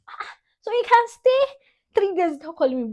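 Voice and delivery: high mock-outraged dad voice; imitating dad. Daughter imitating her dad's complaint, mock outrage. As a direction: play dad scolding, shrill and loud. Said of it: "you're going to translate it to".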